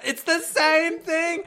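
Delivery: high, loud, slow disbelieving cry; exasperated outburst. Real, not acted. Catching the site repeating itself, a high, loud, slow cry. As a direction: throw your hands up at the repeat.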